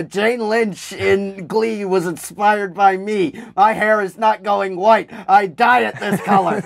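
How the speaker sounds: high, slow, emphatic teacher voice; gym teacher impression. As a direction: ham up the proud coach.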